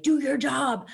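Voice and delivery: high, loud retold call; exasperated order. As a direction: call it out like scolding lazy helpers. Retold shout at the crows.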